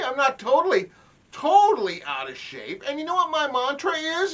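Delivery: loud boasting. Retold or imitated. Dad boasts loudly to son.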